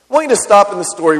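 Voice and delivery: ordinary talk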